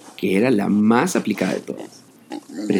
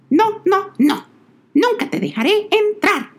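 little pig voice. A narrator's reading. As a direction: refuse the wolf firmly. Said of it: "la cerdita respondió".

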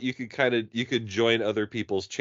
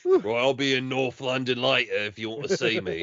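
mock London accent; 2 semitones higher than usual. Inviting the mum out, mock London accent. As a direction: fake English pick-up line.

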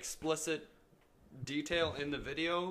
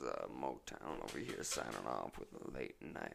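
much lower, drowsy radio voice; sleepy voice; 12 semitones lower, 7 dB quieter than usual. Pretend. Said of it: "this is my sleepy voice".

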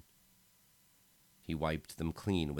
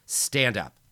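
commanding order; 6 semitones higher, 10 dB louder than usual. Acted. Lance orders Lars to rise.

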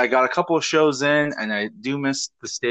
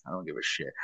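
quiet; 8 semitones lower, 13 dB quieter, faster than usual. Retold or imitated. Apathetic comic this year.

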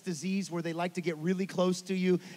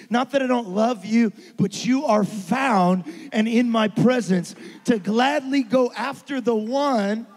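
full-force preaching; 3 semitones higher, 10 dB louder than usual. Acted. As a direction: lean into it for the church. Preaching climax.

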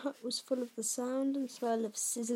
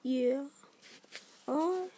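slow, higher, halting words; bewildered disbelief; 5 semitones higher, 4 dB louder, slower than usual. A narrator's reading. Hagrid finally asking Harry, bewildered. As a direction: draw it out, dumbfounded. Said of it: "he said finally".

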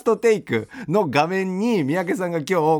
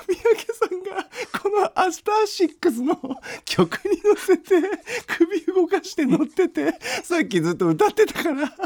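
high, giggling through the words; barely getting words out for laughing; 13 semitones higher than usual. Real, not acted. Host cracking up at guest.

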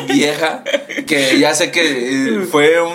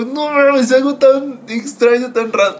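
higher, stunned quote; mock astonishment; 9 semitones higher, slower than usual. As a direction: replay the shock, pitched up. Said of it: "yo me quedé así como". (asombro exagerado, voz más aguda)

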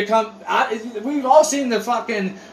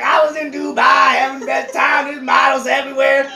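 high, loopy mock shout; drugged kid; 6 semitones higher than usual. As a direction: imitate a doped-up kid boasting in a raised voice. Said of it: "He's like".

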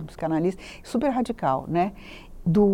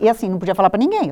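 ordinary talk

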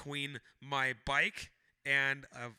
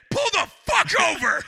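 high, loud, furious yell; furious shout; 10 dB louder than usual. Retold or imitated. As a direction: scream it at the driver like you mean it. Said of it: "I just am screaming inside of my helmet".

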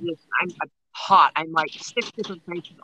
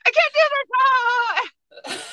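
retold helpless laughter; much faster than usual. Retelling how they lost control mid-massage, helpless high laughing cry. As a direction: squeal it out, cracking up. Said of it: "I started laughing".

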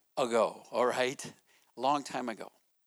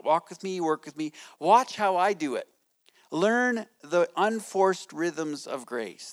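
scripture reading; 4 semitones higher, 5 dB louder than usual. Acted.

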